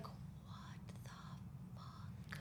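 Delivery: whispered disbelief, retold; stunned whisper. Client hearing a message from her mother, stunned whisper. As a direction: whisper it as if floored.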